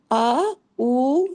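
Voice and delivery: آ and او each held long; long vowel sounds. Shown as a demonstration. Teacher demonstrates the madd vowels to students, drawn out. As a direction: draw out آ and او. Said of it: "یہ آوازیں آپ نے کہاں نکالنی ہیں".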